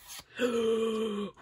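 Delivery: sharp, high gasp of surprise; excited gasp. Real, not acted. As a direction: gasp as the rare card appears. Pulls the rare Celebi card.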